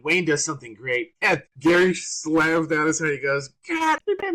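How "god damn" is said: high, loud mock outburst. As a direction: blurt it out like a loud joker. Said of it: "to be loud and crazy and funny".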